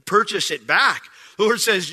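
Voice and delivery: ordinary talk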